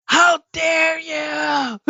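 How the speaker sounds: high, slow, drawn-out mock scream; retold mother's scream. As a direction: scream it down the stairs like an outraged mom. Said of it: "start screaming from upstairs".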